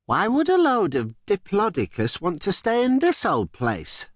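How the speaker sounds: a hint of a bright puzzled girl voice. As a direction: ask it like you can't see the point.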